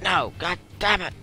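frustrated outburst. Real, not acted. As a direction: curse at bad luck.

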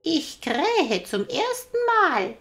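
very high, loud, slow rooster call. Acted. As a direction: crow it down like a golden cockerel. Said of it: "rief der goldene Hahn herunter".

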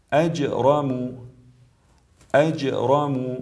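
slow اجرم with short bounce on ج; brief qalqala. Shown as a demonstration. Tajweed teacher gives example of jim, bouncing ج in اجرم. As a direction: release the ج of اجرم briefly before the ر. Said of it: "مثالی از جیم".

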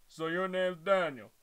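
Kronk impression. Shown as a demonstration. Reader trying to voice the big guy as Kronk, lightly. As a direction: attempt a big lunk of a henchman. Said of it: "I'm trying to do a Kronk impression".

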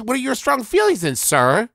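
normal talking voice

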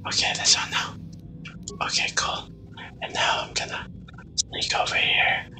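sneaky whisper. Real, not acted. Gamer sneaking past enemies, whispering. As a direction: whisper so the enemies won't hear.

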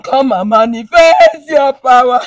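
slow, loud wailed plea; crying. Real, not acted. Woman begs God to show power, wailing. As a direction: cry the prayer out, drawn out. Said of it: "You have started again".

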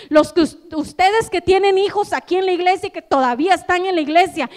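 loud, driving sermon voice; full-force preaching. Acted. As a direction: preach it out to the whole church.

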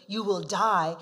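loud stern warning; God sternly warns of death. Acted. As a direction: hand down the verdict firmly. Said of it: "when he says sternly".

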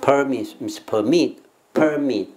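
slow, careful "permit", each syllable clear; pronunciation demo. Shown as a demonstration. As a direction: model "permit" slowly for the class. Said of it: "再听一次". (放慢示范 permit 的发音)

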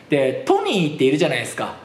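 normal talking voice

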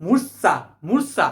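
মূর্ছা slowly, leaning on the s sound; pronunciation demo. Shown as a demonstration. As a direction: sound out মূর্ছা with a held s before the final vowel. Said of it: "উচ্চারণটা কি?".

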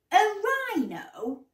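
high, disbelieving question; incredulous. A narrator's reading. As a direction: echo it in amazed disbelief. Mum cannot believe Daisy.

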